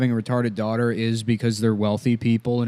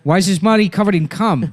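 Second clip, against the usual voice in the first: higher, agitated crook impression; Buscemi; 6 semitones higher, 3 dB louder than usual. Kidnapper questioning Jerry, agitated Buscemi impression. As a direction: demand an explanation as an exasperated crook. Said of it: "Why is he so fucking hard to do?".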